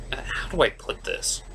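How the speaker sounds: hushed, hesitant aside; whisper. Real, not acted. Reviewer whispering an awkward question to the audience. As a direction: drop to a whisper, unsure how to ask.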